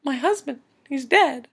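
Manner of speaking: mourning her husband, quietly; grieving wife. Retold or imitated. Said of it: "she's still like".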